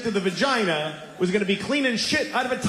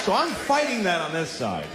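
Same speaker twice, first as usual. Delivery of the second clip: usual speaking voice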